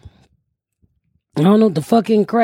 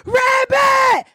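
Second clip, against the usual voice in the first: high, drawn-out yell; excited calling out. Retold or imitated. Woman hailing Rabbit from outside the car, excited calling out. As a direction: holler it across the street.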